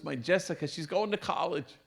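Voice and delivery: usual speaking voice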